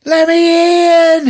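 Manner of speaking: high, loud drawn-out yell, then cackle; hammed-up crazed howl; Old Greg. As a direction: demand entry like a laughing maniac. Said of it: "And he's laughing".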